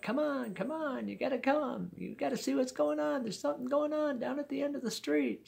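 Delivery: put-on high-pitched, eager kid pleading to come along; excited kid urging. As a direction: imitate excited buddies, voice way up high. Kids urge him at window.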